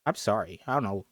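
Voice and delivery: ordinary talk, nothing marked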